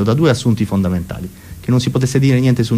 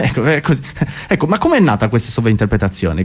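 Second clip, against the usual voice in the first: ordinary talk, nothing marked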